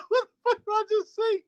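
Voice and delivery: high, cracking laughing words; laughing disbelief. Real, not acted. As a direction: laugh out the question. Laughing retelling shock.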